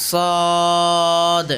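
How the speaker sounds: সদ drawn out on a level tone; careful letter demonstration. Shown as a demonstration. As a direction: hold the rounded vowel of সদ long, then release the d. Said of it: "খেয়াল করতে হবে মনোযোগ দিয়ে".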